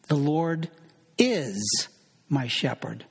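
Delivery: "is" drawn out long between pauses, falling; stress on is. Shown as a demonstration. Preacher showing there is no doubt about it, stress on "is". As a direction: hold the word "is" firmly on its own. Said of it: "emphasize different words in a given verse".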